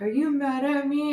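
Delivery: put-on high little-kid question; imitated worried daughter. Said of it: "she's like".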